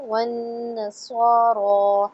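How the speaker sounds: वन्नसारा with the long vowel held for two beats; teacher drawing out वन्नसारा. Shown as a demonstration. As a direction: stretch the vowel in वन्नसारा slowly. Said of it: "दू को दो हरकत लंबा करेंगे".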